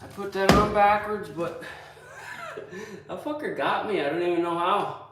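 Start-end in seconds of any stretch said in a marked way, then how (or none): 2.2-3.0 quick high burst of laughter at himself; short laugh. Real, not acted. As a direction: laugh it off, caught out by the tape. Laughs at his own mistake.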